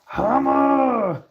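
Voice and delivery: loud high shout of the word; sudden high call of alarm. Real, not acted. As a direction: bark it out as a warning. Warning the dog, hammer.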